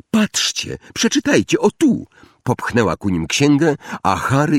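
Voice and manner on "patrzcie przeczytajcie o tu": niecierpliwe podekscytowanie. A narrator's reading. Hermiona niecierpliwie pokazuje księgę.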